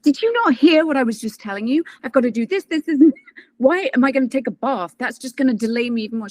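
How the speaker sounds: high, indignant disbelief; incredulous retort with a laugh; exasperated. Retold or imitated. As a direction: push back at the advice.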